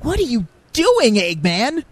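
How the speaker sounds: high, slow shocked hero; Sonic, surprised. Pretend. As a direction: demand it in disbelief. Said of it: "like, a surprise reaction".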